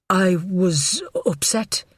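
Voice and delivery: slow, hesitant nervous man. A narrator's reading. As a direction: hold back, say it slowly and unsure. Godfrey's nervous voice.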